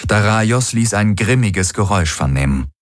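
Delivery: plain reading voice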